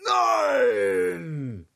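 indignant lion shout; lion roaring. A narrator's reading. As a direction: roar it as the lion. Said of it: "brüllte der Löwe".

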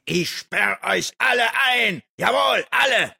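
furious high shout; wütendes Fluchen. A narrator's reading. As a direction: roar the threat at all of them. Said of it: "Der gefangene Löwe fluchte". (wütend und hoch brüllen)

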